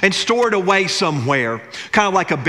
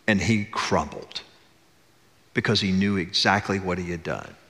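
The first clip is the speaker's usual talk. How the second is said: hushed solemn storytelling; 8 semitones lower, 4 dB quieter than usual. Acted. Preacher tells the congregation of Peter's broken weeping, hushed and low. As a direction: let the weight of the betrayal sink in quietly.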